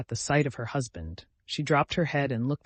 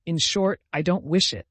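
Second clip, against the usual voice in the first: firm refusal; 4 semitones higher, 6 dB louder than usual. A narrator's reading. Anna's firm refusal, louder.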